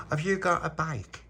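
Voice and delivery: put-on high, childlike question; small child asking. Little boy asking his friend, childlike. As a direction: ask it like a little kid. Child voiced in retold story.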